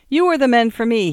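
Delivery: loud, high, wild glee; terrible gaiety. Acted. Young man welcoming fellow suicides with terrible gaiety. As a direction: burst out with an almost frightening joy. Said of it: "with an almost terrible gaiety".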